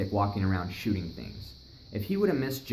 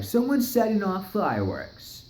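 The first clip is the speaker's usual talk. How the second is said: skeptical neighbor; 9 semitones higher, 3 dB louder than usual. Retold or imitated. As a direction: repeat their excuse like you don't buy it. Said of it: "he's all like".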